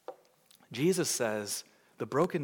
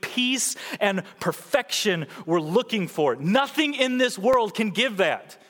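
louder, higher call to the church; fervent preaching; 9 semitones higher, 6 dB louder than usual. Acted. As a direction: plead with the Christians, full force. Preaching at full force.

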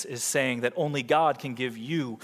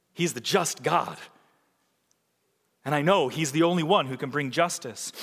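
ordinary talk

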